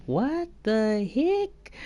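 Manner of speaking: slow, high, stretched-out disbelief; baffled exclamation. Real, not acted. Streamer lost in German words, slow high bafflement. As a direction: draw it out, rising in bewilderment.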